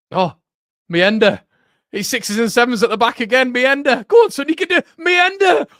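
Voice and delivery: very high, loud frantic yelling at the player; real high-pitched football fan shout; excited match shouting. Real, not acted. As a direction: urge him on at the top of your voice. Calling out during live match action.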